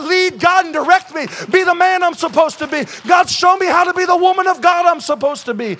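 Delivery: loud, high, urgent prayer call; soaring revival preaching; full-force preaching. Real, not acted. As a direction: preach it, urging them to rise.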